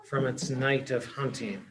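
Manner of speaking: plain reading voice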